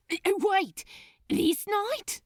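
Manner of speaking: high, loud, stammering burst of alarm; Crowley suddenly realising the disaster, loud and high; alarmed interruption. Acted. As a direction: blurt it out, cutting him off in shock. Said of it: "interrupted Crowley, unable to contain himself".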